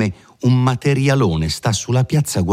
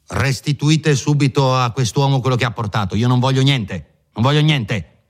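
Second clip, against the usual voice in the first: higher, raised order to the servant; shouting; 6 semitones higher than usual. Acted. Lawyer ordering the maid, higher and raised. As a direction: call out sharply for the maid. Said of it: "sempre gridando".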